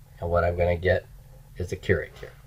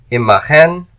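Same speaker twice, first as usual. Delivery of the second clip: loud, clear model for students; repeat-after-me model; 8 semitones higher, 7 dB louder than usual. Shown as a demonstration. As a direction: project the word for the class to echo. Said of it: "just repeat after me".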